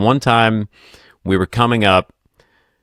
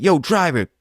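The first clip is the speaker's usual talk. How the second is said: higher-pitched mock call to the driver; retold yelling; 7 semitones higher than usual. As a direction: imitate an urgent passenger calling out, without real shouting. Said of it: "they're yelling back and forth".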